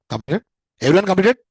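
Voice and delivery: loud, high rising question; calling out to class. Real, not acted. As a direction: check on the whole room, loudly. Checking on the whole class.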